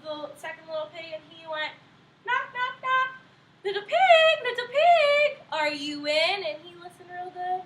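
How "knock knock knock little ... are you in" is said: high, louder calling wolf voice. Acted. Wolf calling through the door to the pig. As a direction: sing out to the pig inside.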